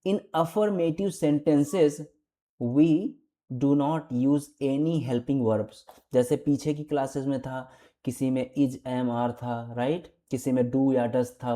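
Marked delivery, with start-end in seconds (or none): 0.1-5.8 very slow, word by word read-out; teacher dictating a rule; slow dictation. Shown as a demonstration. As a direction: spell the rule out slowly for beginners. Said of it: "अब इसमें देखेंगे क्या लिखा है".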